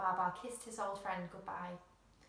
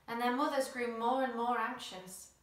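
usual speaking voice

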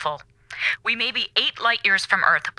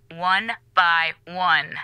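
slow threat; 2 semitones lower, much slower than usual. Acted. Threat to flush cigarettes.

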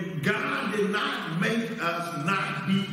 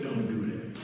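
low, soft inner voice of warning; a quiet voice of conscience cautioning you; little inner voice; 11 semitones lower, 6 dB quieter than usual. Retold or imitated. Preacher voicing the little inner voice, low and soft. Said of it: "did you hear that little voice say".

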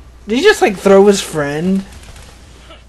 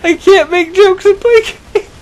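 very high, loud, near-crying with laughter; hysterical laughing wail; 13 semitones higher, 6 dB louder than usual. Real, not acted. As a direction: wail helplessly through a real laughing fit. Helpless laughing fit over botched joke.

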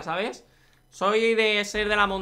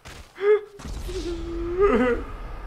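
high laugh; 12 semitones higher than usual. Real, not acted. Falls off after friend passes.

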